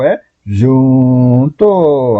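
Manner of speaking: "junto" stretched very slowly; slow, elongated teacher's answer; drawn-out answer. Real, not acted. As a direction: hold each syllable of "junto" long. Answer spelled out for students.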